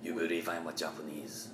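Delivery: put-on quiet, low Japanese accent still held; soft lightly accented Japanese line. Comic teasing host after the bit, quiet Japanese accent. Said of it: "I almost".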